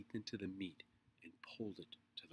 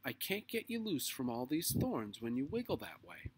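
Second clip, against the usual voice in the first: raised, louder big-sister complaint; exasperated directing; 6 semitones higher, 6 dB louder than usual. A narrator's reading. Beezus freeing her little sister from thorns, exasperated. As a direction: scold while struggling to free someone. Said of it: "directed Beezus, struggling with her little sister".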